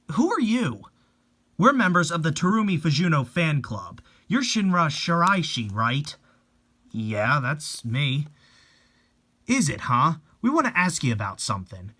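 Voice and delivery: normal talking voice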